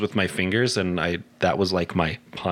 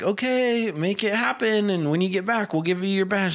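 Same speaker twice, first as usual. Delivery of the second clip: high-pitched mock scout leader; sing-song send-off, pitched way up; 10 semitones higher than usual. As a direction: imitate a leader chirping encouragement from afar. Leaders send scared kid off.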